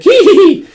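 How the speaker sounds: high shrill giggle, very loud; gleeful cackle. Acted. Rocco cackling while firing the Gatling gun. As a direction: cackle like a delighted gremlin.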